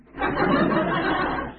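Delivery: high burst of laughter; amused high laugh. Real, not acted. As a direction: laugh at the memory. Laughs at the cashier scene.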